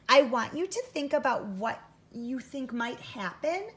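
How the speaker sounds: ordinary talk, nothing marked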